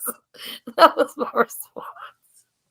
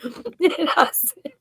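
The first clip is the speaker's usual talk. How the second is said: whispered laugh; 6 semitones higher, 7 dB quieter, slower than usual. Real, not acted. Laughing while telling.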